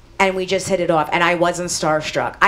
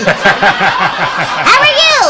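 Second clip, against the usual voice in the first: joking tiny-man voice, loud and drawn out; tiny little man; 8 dB louder, much slower than usual. Retold or imitated. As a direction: exaggerate a little man's greeting for laughs. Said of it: "He's tiny, tiny".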